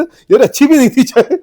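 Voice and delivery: mock complaint. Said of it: "तू कहता".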